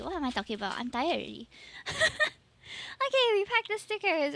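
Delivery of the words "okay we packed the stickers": high laughing okay. Real, not acted. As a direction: giggle into okay. Laughs at herself.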